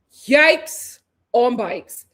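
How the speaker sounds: very slow, loud "Yikes", pause, "on bikes"; drawn-out emphasis. Real, not acted. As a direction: stretch "Yikes" and break before "on bikes". Repeats catchphrase, stretched for emphasis.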